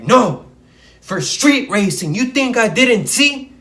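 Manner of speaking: loud, high, indignant cop voice; accusing cop. Retold or imitated. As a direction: jab it at him like a suspicious cop. Cop accusing him of racing.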